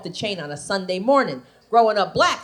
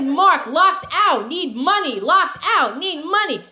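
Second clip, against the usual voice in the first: caveman husband; 6 semitones higher, slower than usual. Retold or imitated. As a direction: spell it out slowly like a caveman. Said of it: "He said".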